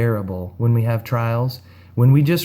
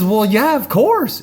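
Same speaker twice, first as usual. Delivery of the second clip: mock woman's voice, pitched much higher; 9 semitones higher, slower than usual. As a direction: lift the voice high for her obvious "of course". Woman answering, voiced in story.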